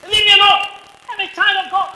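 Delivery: high, loud, sharp refusal; loud rebuke. Shown as a demonstration. Preacher showing how to rebuke a boy who touches her, loudly. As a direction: bark it at the boy. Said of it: "Tell me to rebuke him".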